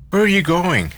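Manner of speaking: high, sudden, alarmed question; startled demand, voice jumping up. A narrator's reading. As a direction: call out in immediate alarm. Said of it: "demanded Gatsby in immediate alarm".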